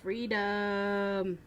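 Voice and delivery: soft, drawn-out echo of a battle cry; hushed, playful echo; soft mock echo. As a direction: trail it out quietly like a distant cry. Echoes the cry softly.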